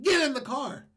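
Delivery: loud, high mock call; imitated urgent command. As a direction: call out as if from a car pulling up. Woman calls to him from car.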